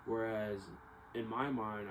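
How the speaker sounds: ordinary talk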